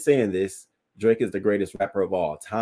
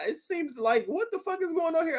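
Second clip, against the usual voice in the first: incredulous disbelief; 6 dB quieter than usual. Real, not acted. Disbelief at [name]'s behaviour.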